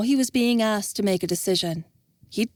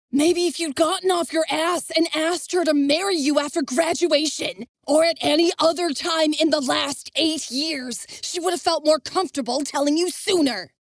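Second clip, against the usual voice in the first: higher, heated tirade; raging; 9 semitones higher than usual. Acted. Devon berating Envis, raging. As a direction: lay into him in anger. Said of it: "Devon raged".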